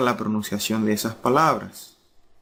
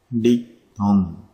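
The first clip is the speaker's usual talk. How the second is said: drawn-out key term; much slower than usual. Shown as a demonstration. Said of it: "teacher stressing key term".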